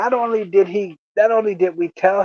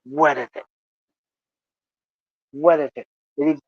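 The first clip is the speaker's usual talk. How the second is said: ordinary talk, nothing marked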